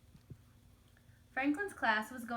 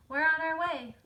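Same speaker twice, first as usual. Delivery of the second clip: loud, higher eager announcement; hearty call; 6 semitones higher, 10 dB louder than usual. A narrator's reading. Franklin scooping Snail up, loud and upbeat. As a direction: boom it out like a keen leader. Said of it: "said Franklin".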